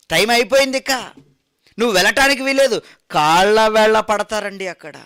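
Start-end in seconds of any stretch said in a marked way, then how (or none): 0.1-2.8 higher, louder, very fast final verdict; stern refusal. Acted. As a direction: slam the door on him, loud and quick. Officials turn the runner away. (గట్టిగా, వేగంగా తిరస్కరించే అధికారి)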